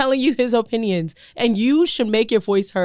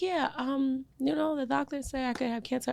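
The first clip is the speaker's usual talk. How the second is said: offhand, softer imitation of a loved one; casual; 5 semitones higher, 8 dB quieter than usual. Loved one breaking health news, mock-casual. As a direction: toss it off lightly, higher and softer. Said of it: "so, so casually too. She was like".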